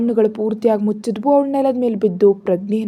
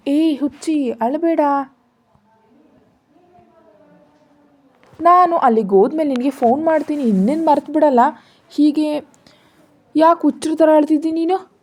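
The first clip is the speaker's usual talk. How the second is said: higher-pitched, lightly chiding reassurance; brushing off tears; 5 semitones higher, 4 dB louder than usual. A narrator's reading. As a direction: shush a crying lover in a raised pitch. Lover shushing the crying woman. (ಅಳಬೇಡ ಎಂದು ಸ್ವಲ್ಪ ಗದರುತ್ತಾ ಸಮಾಧಾನ)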